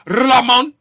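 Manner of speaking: Ramon with a long trilled R, loud; rolled R. Retold or imitated. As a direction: roll the R hard at the start of Ramon. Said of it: "he said his name is Ramon".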